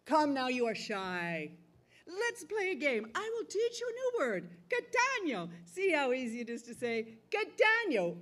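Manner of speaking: high, sing-song coaxing of a bird; coaxing rich man. Acted. Rich man coaxes the parrot.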